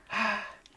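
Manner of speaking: short high huffing breath; sharp, airy pant; imitated panting. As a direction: mimic someone panting down the phone. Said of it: "like, panting, you know what I mean?".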